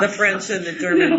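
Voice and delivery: laughing defence of his negative remark. Real, not acted. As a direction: laugh it off while explaining. Still laughing.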